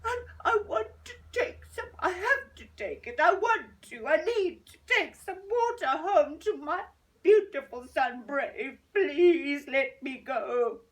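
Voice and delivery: high, loud crying plea; tearful pleading. Acted. Old lady begging the tree to free her. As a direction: beg through tears.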